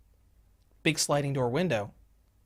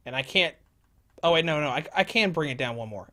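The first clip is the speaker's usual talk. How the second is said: ordinary delivery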